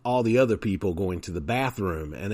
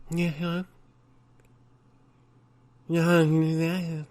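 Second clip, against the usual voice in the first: higher, slurred, vacant; a light put-on simple voice; mock dim-witted voice; 8 semitones higher, slower than usual. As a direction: play someone not all there. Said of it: "talk gibberish".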